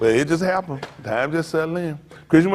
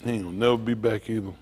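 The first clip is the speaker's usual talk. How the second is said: soft lower rueful aside; rueful mutter; 7 semitones lower, 11 dB quieter than usual. Retold or imitated. Old man looking at old photos, soft regret. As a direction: mourn your young self quietly. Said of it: "I said".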